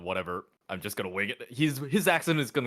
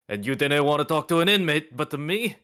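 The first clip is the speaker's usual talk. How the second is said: put-on louder, lightly Italian-accented challenge; slightly Italian accent; 4 semitones higher, 7 dB louder than usual. Guard questioning a visitor's reason for coming, slight Italian accent. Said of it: "like, slightly Italian?".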